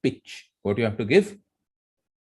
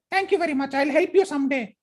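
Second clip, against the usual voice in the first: much higher, tiny squeaky voice; squeaky mouse. Acted. Grateful mouse thanking the lion, in a squeaky voice.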